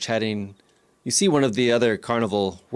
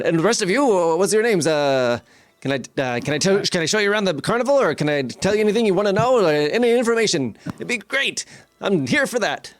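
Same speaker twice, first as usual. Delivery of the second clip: high, gushing showman greeting; excitable carnival barker; 6 semitones higher than usual. Acted. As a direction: gush at the newcomers like a barker. Game master plays carnival barker.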